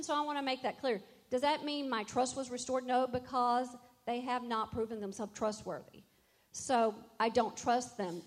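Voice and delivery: loud, driving sermon build; full-force preaching. Real, not acted. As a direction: hammer the point home to the congregation.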